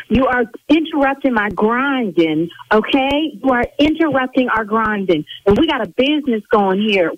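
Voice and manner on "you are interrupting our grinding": usual speaking voice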